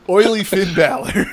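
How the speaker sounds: loud, slow, laughing; mock hype; announcer flourish. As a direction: proclaim it like a ring name. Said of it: "naming the gimmick".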